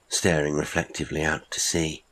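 ordinary delivery, nothing marked

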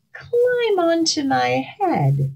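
high, slow and smug; smirking fox. Acted. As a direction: smirk through a sly offer. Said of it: "smirked the fox".

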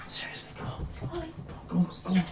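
hushed, breathy plea to the dog; exasperated whisper to a dog; whispered coaxing. Real, not acted.

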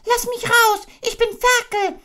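a desperate little pig; squealing Piglet. Acted. Piglet begging to get out of the bath.

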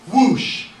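high, explosive whoosh effect; loud whoosh. A narrator's reading. Narrator imitating the dropped match igniting, loud whoosh. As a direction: burst it out as a sound effect.

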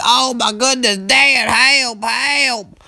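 high, loud frantic cry for help; panicked kid. Retold or imitated. Boy calling his dad for help in panic. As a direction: yelp for help like a terrified boy.

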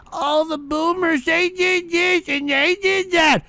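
high, whiny mock voice, slowing down; mocking whiny complainer. Host mocks callers whining about boomers, high whiny mockery. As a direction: sneer at them in a nasal whine.

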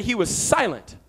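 ordinary talk

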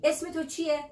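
normal talking voice

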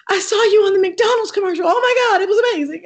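high, loud, fast starstruck gushing; imitating her younger gushing self; gushing fan. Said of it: "I said".

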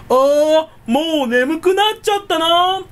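high, loud theatrical call; mock announcing. Pretend mansion owner cues the bedtime show, high hammed-up call. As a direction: announce sleepiness like a cue to servants. Acts rich person's signal for bedtime.

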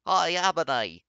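loud, high mock shout; shouted greeting. A narrator's reading. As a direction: call it across the room, hammed up. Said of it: "shouted a voice".

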